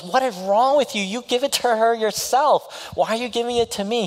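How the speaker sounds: very high, exasperated woman's voice; exasperated woman voiced. Heroine refusing the letter from the hero, very high and exasperated. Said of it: "And she goes".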